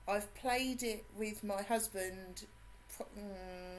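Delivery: usual speaking voice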